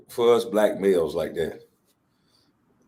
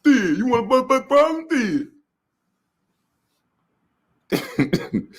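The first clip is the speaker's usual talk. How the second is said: tough Shannon impression; 12 semitones higher than usual. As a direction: bark it like jumping out the car. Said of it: "That is".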